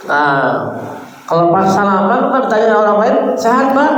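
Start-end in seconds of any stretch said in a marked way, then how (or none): 3.4-3.9 higher, bright question of a friend; asking after health. Retold or imitated. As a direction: ask warmly, as if shaking hands. Acted question when shaking hands.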